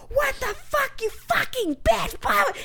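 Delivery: high, mock furious yell; retold scream. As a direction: imitate a raging driver screaming. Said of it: "screaming at me at the top of his lungs".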